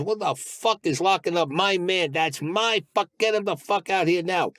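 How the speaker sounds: high, slow mock yell; retold yelling. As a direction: hammer out each demand up high. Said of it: "And he's yelling".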